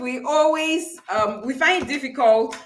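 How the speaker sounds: ordinary talk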